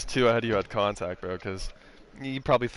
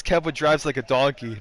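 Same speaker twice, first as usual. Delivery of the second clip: quoting T-Bone; 3 semitones higher, 5 dB louder than usual. Retold or imitated. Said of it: "T-Bone's one comment".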